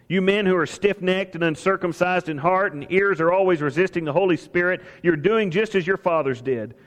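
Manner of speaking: ordinary talk, nothing marked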